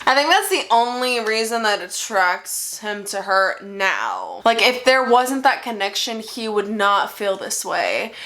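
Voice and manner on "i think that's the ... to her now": ordinary talk, nothing marked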